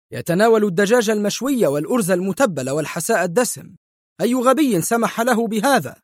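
high, fast, furious tirade; the doctor tears into the nurse; sharp angry shout. A narrator's reading. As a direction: pitch climbing with rage. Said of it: "صاح الطبيب في حدة".

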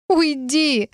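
high, pitiful little plea; plaintive, high-pitched voice. A narrator's reading. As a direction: beg pitifully to be left alone. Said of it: "попросила я уже жалобно".

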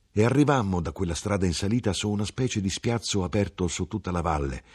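ordinary delivery

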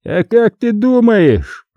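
high, slow malicious taunt; angry, triumphant. A narrator's reading. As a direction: draw the words out high, gloating. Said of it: "В голосе звучала злость и что-то ещё". (злобно, с торжеством, высоко и протяжно)

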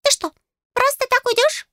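very high, squeaky protest; high-pitched miffed fairy; affronted fairy. A narrator's reading. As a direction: object in a tiny piping voice. Fairy protests her leaving.

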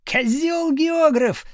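high, drawn-out jeer; yelled insult. A narrator's reading. Pupil yelling back from the corridor, insulting the teacher. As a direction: holler it slowly at a high pitch. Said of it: "заорал Градусов оттуда".

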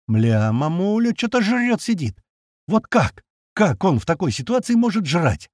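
drawn-out start climbing high; high-pitched amazed questioning; incredulous. A narrator's reading. Narrator watching crewmate eat mid-battle, incredulous.

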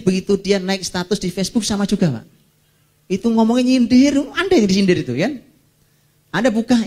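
ordinary delivery

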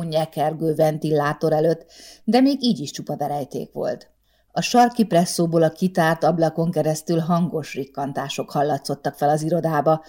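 plain reading voice

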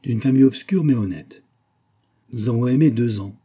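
lifted, lightly declaimed solemn tale; mock bardic recital. A narrator's reading. Vera plays bard for her friend, mock bardic recital. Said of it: "prit une physionomie de barde ossianique".

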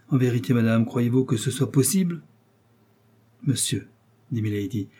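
ordinary delivery, nothing marked

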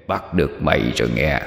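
low, rasping whisper; hoarse whisper. Acted. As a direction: whisper it low like a sick old man. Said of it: "Một giọng nói thì thầm bên tai, khàn đục như người ho lao".

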